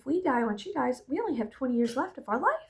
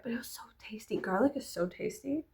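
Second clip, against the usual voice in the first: whisper; 7 dB quieter than usual. Real, not acted. Savouring memory of garlic.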